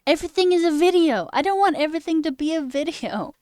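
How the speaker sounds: fed-up outburst; exasperated rant. Real, not acted. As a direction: vent with rising annoyance. Heated rant.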